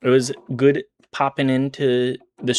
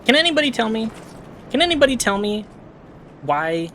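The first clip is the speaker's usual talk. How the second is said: squeaky exasperated plea; high-pitched whine; 10 semitones higher, faster than usual. Real, not acted. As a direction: plead up high in disbelief. Exasperated at game.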